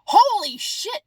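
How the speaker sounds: high, loud startled exclamation; mock shock. Imagining people hearing disclosure, loud high shocked blurt. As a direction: blurt it like the news just hit you. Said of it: "there's gonna be a lot of".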